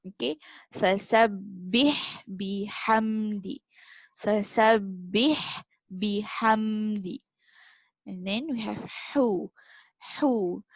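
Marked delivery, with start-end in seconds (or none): 0.8-7.2 "Fasabbih bihamdi" slow with breathy Ha sounds; slow the phrase and push air through the Ha; airy Ha. Shown as a demonstration. Teacher modelling the verse word by word. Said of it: "feel the air".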